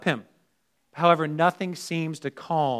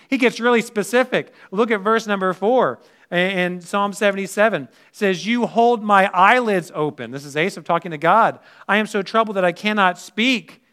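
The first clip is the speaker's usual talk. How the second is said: louder, higher preaching voice, driving the verse home; preaching at full force; 5 semitones higher, 6 dB louder than usual. Acted. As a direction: proclaim it to the congregation. Preacher reading psalm with force.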